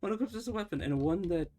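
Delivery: real giggle breaking in; laughing. Real, not acted. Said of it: "sorry, I'm just laughing".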